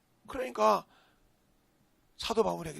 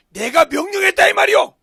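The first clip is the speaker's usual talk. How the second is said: bold shout; 11 semitones higher, 21 dB louder than usual. Acted. Preacher voices David's bold confession.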